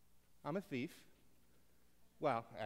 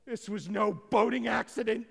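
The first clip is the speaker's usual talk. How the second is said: loud, high dramatic movie line; hammed-up actor; 9 semitones higher, 11 dB louder than usual. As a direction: overplay it like an audition piece. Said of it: "why not be an actor?".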